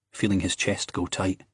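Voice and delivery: usual speaking voice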